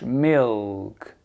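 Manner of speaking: low "Milk" with a resonant, swallowed L; dark L. Shown as a demonstration. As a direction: let the L of "Milk" ring in the mouth. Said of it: "il résonne dans la bouche".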